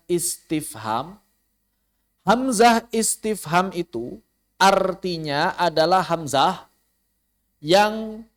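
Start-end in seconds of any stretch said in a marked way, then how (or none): none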